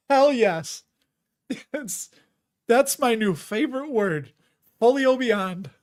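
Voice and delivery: loud, high-pitched amused delight; delighted outburst. Real, not acted. Streamer relishing a funny name he stumbled on, gleefully. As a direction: crow over a hilarious new favorite.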